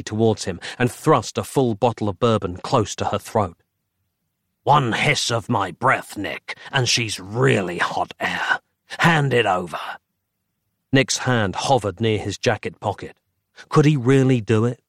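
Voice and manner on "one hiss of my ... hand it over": threatening rasp. Acted. Dark fraction threatens.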